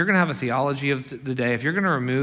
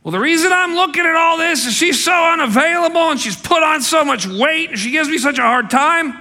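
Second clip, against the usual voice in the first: much higher, loud whiny complaint; resentful husband; 11 dB louder than usual. Acted. Preacher voicing a bitter divorced husband, loud and high. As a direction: shout it as the self-justifying husband.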